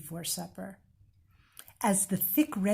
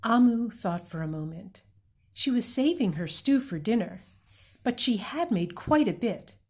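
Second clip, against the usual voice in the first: ordinary delivery, nothing marked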